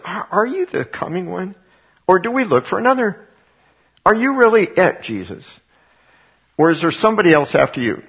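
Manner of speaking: high, pleading, doubting question; doubting inflection. Retold or imitated. John the Baptist's disciples asking Jesus, doubting and pleading up high. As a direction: press him anxiously, voice rising high. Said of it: "put the inflection the way I think he said".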